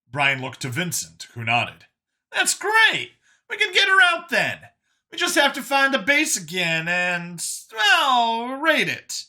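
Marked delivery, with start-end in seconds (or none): 2.3-9.2 very high, louder burst of enthusiasm; high and thrilled, then working out the plan; excited. Acted. Brian planning to rescue her, excited.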